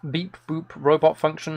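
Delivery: normal talking voice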